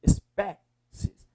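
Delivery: "Espécies" slowly, leaning on the middle syllable PÉ; es-PÉ-cies, strong syllable held and set off; stress on PÉ. Shown as a demonstration. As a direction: draw out the PÉ in "Espécies". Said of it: "é o pé".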